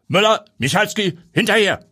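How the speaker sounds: high, loud order; shouted command. Acted. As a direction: bark the names out. Police chief barking orders.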